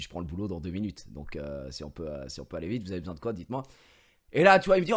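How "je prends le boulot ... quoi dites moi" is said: hushed, busy. Retold or imitated. Busy client rushing the banker on the phone, hushed and low. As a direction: lower and softer, talking under your breath. Answering banker while in a meeting.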